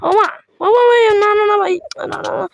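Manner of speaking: very high, frantic cries of panic; real alarm at dying, pitch shooting up. Real, not acted. Gamer near death on screen, frantic high-pitched panic. About to die in game.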